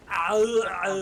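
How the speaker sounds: loud grunting Arnold; Arnold impression. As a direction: bark it like Schwarzenegger. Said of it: "my Arnold impression".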